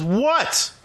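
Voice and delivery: sharp high mock yell into the phone; a hammed-up read-aloud yell. A narrator's reading. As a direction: snap it out as a playful yell. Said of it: "and yelled".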